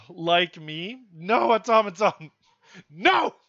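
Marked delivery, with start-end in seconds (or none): 2.9-3.3 high, loud refusal; sharp no. Real, not acted. Vlogger refusing to be a guru, sharp shouted no.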